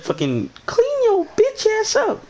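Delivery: slow high-pitched scolding voice; falsetto scolding. Retold or imitated. Telling his friend to clean himself up, slow falsetto scold. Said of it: "I said".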